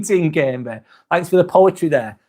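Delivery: normal talking voice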